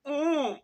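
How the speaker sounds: begging whimper. Shown as a demonstration. Demonstrating to the audience a beggar's pleading oh. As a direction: whimper like a beggar asking for alms. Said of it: "a begging sound like this".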